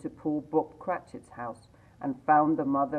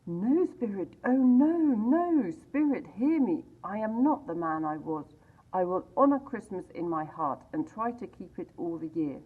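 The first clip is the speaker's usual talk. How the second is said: loud, higher, begging for his life; desperate plea; 5 semitones higher, 5 dB louder than usual. A narrator's reading. Scrooge at his own grave begging the phantom, desperate. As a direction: cry out to the spirit, then vow. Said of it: "trembling as he went".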